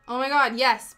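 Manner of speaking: ordinary talk, nothing marked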